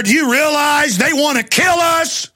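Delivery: high, slow, shouted warning; slow shouted alarm; shouting. Real, not acted. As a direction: yell it at the crowd like an alarm. Ranting host opens with shout.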